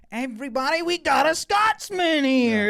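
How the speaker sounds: loud, high-pitched imitation of a rousing announcer; announcing shout. Piper announcing a Scotsman to the seated group, imitated shout. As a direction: holler it out to the whole group, stretching the words.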